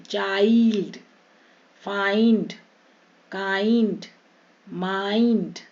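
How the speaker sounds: slow gliding eye sound in each word; long i. Shown as a demonstration. Teacher modelling i as eye, stretched vowel. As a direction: draw out the आइ in "चाइल्ड", "फाइंड", "माइंड". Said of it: "आई के रूप में".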